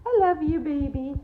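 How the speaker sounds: put-on light sing-song baby talk. Cooing at the dog.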